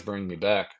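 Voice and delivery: ordinary talk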